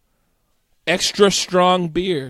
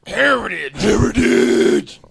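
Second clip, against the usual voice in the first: drawn-out cry, building louder; mock battle cry; 3 semitones higher, 6 dB louder than usual. Co-host echoing the proclamation, mock battle cry. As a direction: chant it, the second louder and longer. Repeated shouted toast.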